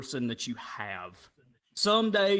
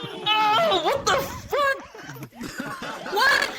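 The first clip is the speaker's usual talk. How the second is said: horrified; slower than usual. Retold or imitated. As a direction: act out panicked disbelief at full squeal. Horrified at ghost inside him.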